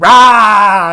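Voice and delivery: loud, high drawn-out battle roar; hammed-up hero's scream. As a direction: belt out a mock war cry. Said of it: "just starts screaming at him".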